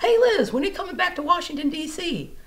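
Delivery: cheerful pleading; eager fans. Retold or imitated. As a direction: friends begging her to come back, high and bright. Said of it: "email me and say".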